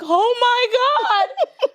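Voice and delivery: drawn-out, high "Oh my God" as if crying; retold crying. Retelling her panic over her daughter's hair, mock crying. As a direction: wail it slowly, imitating her own crying. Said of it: "I was in the house crying like".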